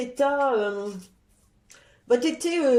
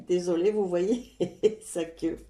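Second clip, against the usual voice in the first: laughing apology; 5 semitones lower than usual. Real, not acted. Cat wanders into the shot.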